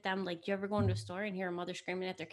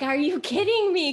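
high, loud disbelieving blurt; exasperated outburst; 9 semitones higher, 9 dB louder than usual. Retold or imitated. Fed-up shopper to oblivious mother, loud exasperated blurt. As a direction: blurt it out at the noisy store mom. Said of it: "I was like".

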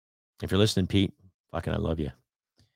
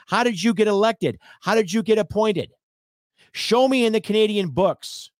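higher and louder, building outrage at politicians; rising indignation; 9 semitones higher, 4 dB louder than usual. Real, not acted. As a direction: hammer the rhetorical questions, incredulous. Ranting at politicians about racism.

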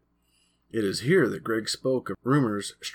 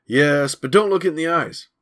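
much louder, higher insistence; 6 semitones higher, 9 dB louder than usual. Acted. Billy to the boys, suddenly louder. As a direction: raise your voice to be heard. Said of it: "Then he spoke louder".

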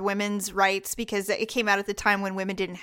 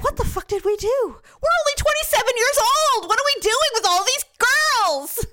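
higher, louder exasperated parent voice; 13 semitones higher, 4 dB louder than usual. Pretend. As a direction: play a young parent at wit's end, higher and louder. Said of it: "looked at each other and said".

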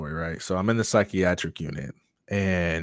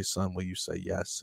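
soft small voice; 12 dB quieter than usual. Retold or imitated. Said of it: "still small voice whisper".

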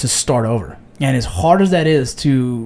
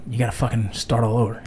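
ordinary delivery

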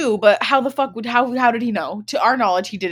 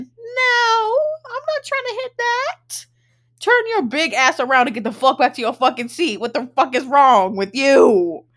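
mock outraged rant; 5 semitones higher, 5 dB louder than usual. Retold inner rant at bartender.

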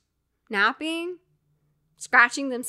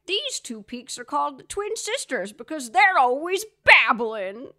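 corny narrator gag, pushed louder; hammed-up vintage ride narration; cheesy ride narrator; 4 semitones higher, 8 dB louder than usual. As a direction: sell the old joke like a showman on the mic. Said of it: "it's like".

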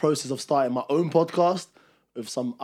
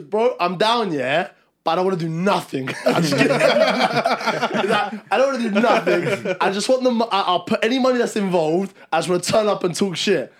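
quoting a mate, higher, louder, laughing; laughing impression; 6 semitones higher, 8 dB louder than usual. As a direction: laugh through the mate's words. Imitating Malcolm, laughing through it.

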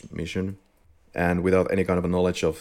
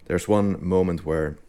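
ordinary delivery

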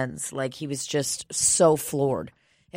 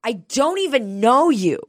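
high, curt little brush-off; snippy kid; 7 semitones higher, 4 dB louder than usual. Retold or imitated. Retelling the six-year-old rebuffing the speaker, high snippy kid voice. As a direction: snap it like an offended child.

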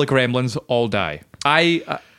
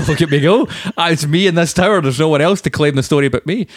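louder, laughing brag; laughing boast; 4 semitones higher, 4 dB louder than usual. Real, not acted. Friend boasting about his victory, laughing. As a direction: laugh through the gloat.

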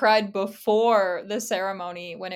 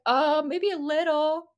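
slow, a bit higher, lightly voiced hesitant guy; hesitant coworker; 6 semitones higher, slower than usual. Coworker Josh answering whether she looks like she cried, a slow hesitant mumble. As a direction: drag it out like someone reluctant to admit it.

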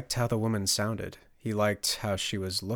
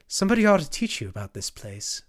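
higher, louder flirtatious woman; raised, playful character voice; friendly woman; 5 semitones higher, 5 dB louder than usual. A narrator's reading. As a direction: offer it invitingly. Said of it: "said the friendly woman".

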